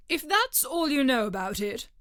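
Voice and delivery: a pompous king dismissing a witness; king's voice. A narrator's reading. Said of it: "continued the king".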